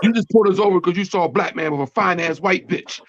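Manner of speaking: imitating her mouthing off at the cop; girlfriend cussing out cop. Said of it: "That's her exact words".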